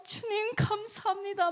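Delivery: high, drawn-out cry of thanks; acted-out praise, slow and lifted high; praising. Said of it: "만왕의 왕을 막 찬양하면서".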